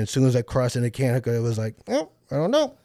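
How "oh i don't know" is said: high-pitched shrugging voice; clueless GPS. Retold or imitated. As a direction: squeak out a helpless non-answer.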